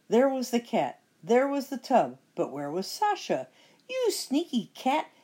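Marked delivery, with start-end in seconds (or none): 3.9-5.1 high witchy yell; angry witch yell. Acted. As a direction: snarl it up high. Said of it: "yelled Bony Legs".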